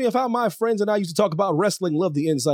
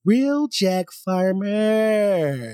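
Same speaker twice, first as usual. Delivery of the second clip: slow, lower announcer read; show host intro; 5 semitones lower, much slower than usual. Acted. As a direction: introduce the guests grandly.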